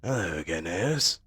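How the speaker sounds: smug, taunting villain voice; menacing captor. Acted. Captor gloating over the prisoner, smug taunting voice. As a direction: toy with the captive by name.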